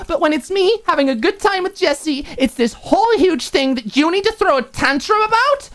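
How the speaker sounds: seething accusation; furious roommate accusing Alicia; boiling anger. Acted. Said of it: "her blood boiling".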